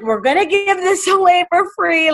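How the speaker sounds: high, laughing delight; excited quote. Retold or imitated. Telling coworkers, high laughing excitement. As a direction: gush it out with a laugh. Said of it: "I'd be like".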